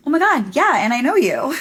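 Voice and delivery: imitating a fan's loud, high delight; excited fan. A fan recognizing her, loud and high. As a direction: gush like a fan spotting you. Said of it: "And she goes".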